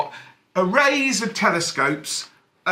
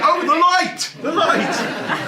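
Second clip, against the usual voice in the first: mock awe; 10 semitones higher, much slower than usual. Mock religious awe at projector.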